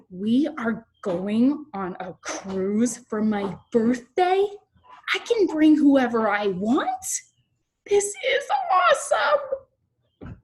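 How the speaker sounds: exaggerated, thrilled read-aloud for children; reading with expression. Shown as a demonstration. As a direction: stretch the words, lift the questions, squeal the finish. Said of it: "one more time with expression".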